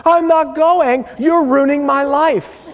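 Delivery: put-on high, loud, wailing teen protest; whiny teen daughter. Dad mimics teen daughter, loud high wail. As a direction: imitate a daughter wailing at her parents.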